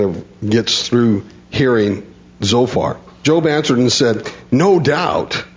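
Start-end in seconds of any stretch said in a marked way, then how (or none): 4.5-5.4 sarcastic. Retold or imitated. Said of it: "absolute sarcasm".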